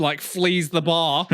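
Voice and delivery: usual speaking voice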